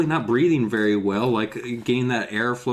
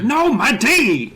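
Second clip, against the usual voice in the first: indignant Doctor D; 11 semitones higher, 5 dB louder than usual. Retold or imitated. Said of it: "He's like".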